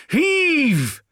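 very high, loud heaving shout; cried command. A narrator's reading. Corvo urging men pushing the statue, straining cry. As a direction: cry out to drive the push. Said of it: "cried Corvo".

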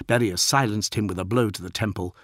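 ordinary delivery, nothing marked

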